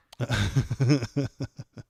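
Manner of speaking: big burst of laughter; loud laugh. Real, not acted. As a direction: laugh out loud at own joke. Laughing at own joke.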